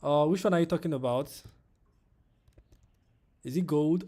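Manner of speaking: real urgency; urgent question. Real, not acted. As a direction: fire the question off quickly. Confused by viewer question.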